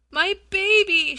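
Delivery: high, loud desperate plea; mother pleading for her baby; pleading cry. A narrator's reading. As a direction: cry out for your child. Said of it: "she said pleadingly".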